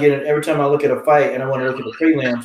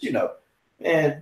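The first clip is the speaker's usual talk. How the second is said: ordinary talk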